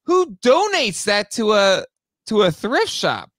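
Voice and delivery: who on earth would give these away; disbelieving high-pitched outcry; incredulous. Real, not acted. Amazed someone donated rare figures.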